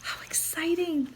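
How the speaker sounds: unvoiced aside, breathless; whisper. Real, not acted. Reader whispering an aside to the listening children. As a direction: whisper it like a secret.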